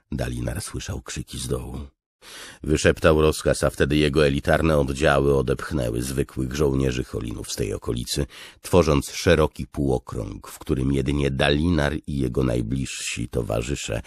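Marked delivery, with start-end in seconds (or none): none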